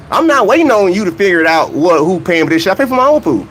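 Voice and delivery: ordinary talk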